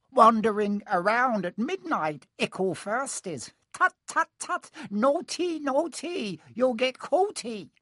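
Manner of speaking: very high, slow sing-song taunt; taunting cackly poltergeist. A narrator's reading. Peeves mocking pupils out at midnight, cackly taunt. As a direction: tease them in a high, drawn-out chant. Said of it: "Peeves cackled".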